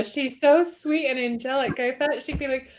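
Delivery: ordinary talk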